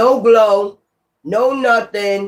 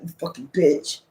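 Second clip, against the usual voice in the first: softer muttered insult, barely voiced; muttered under breath; 3 semitones lower, 7 dB quieter than usual. Someone insulting you after you respond, softer muttered aside. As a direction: mutter it under your breath so they almost miss it. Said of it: "a little mumble underneath the breath".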